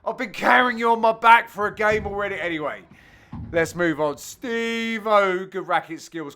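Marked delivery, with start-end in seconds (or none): 0.0-2.4 hammed-up furious rant, louder; imagined inner rage at a partner, a hammed-up louder rant; mock angry South African rant. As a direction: spit it out like a mock-furious teammate. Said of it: "he would have been like".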